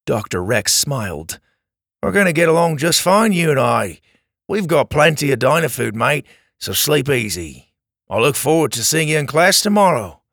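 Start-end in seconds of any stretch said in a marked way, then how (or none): 2.0-10.2 fast, cheerful Australian; Australian accent. Acted. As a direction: warmly brush off the worry, Aussie style. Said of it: "in an Australian accent".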